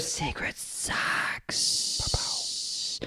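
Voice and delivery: drawn-out stage whisper. Pretend. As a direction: whisper it like a secret segment title. Hushed announcement of a segment title.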